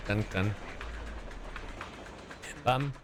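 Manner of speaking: ordinary talk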